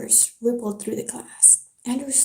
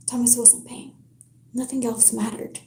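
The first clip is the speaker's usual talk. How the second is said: plain reading voice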